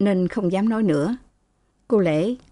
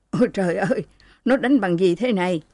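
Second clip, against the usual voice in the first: ordinary delivery, nothing marked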